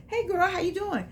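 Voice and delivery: high, bubbly mock greeting; girlfriend greeting. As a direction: greet a friend like an excited woman. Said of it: "women go".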